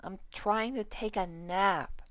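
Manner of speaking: scratchy mare voice. Acted. Ditzy on the plane to the two below, high scratchy complaint. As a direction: grumble down from above, woken up. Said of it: "A scratchy feminine voice rang out".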